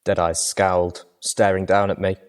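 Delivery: normal talking voice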